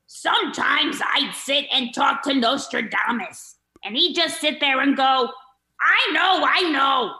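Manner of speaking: loud, high, strained comedian squawk; Gilbert Gottfried voice. Acted. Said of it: "get into my Gilbert Gottfried".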